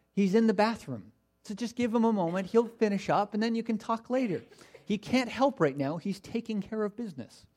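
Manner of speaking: lilting sarcastic explanation; mocking. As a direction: make excuses for their god in teasing mock sympathy. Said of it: "he's making fun of them".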